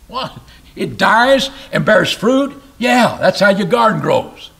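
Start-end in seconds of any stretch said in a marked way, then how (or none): none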